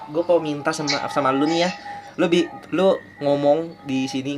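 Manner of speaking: normal talking voice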